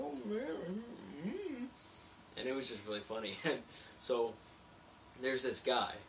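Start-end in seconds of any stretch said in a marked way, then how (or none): 0.0-1.6 high mock-sheepish voice; sheepish reply. Narrator answering the iron workers, high and sheepish. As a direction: play it like a caught kid, pitched way up. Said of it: "And I'm like".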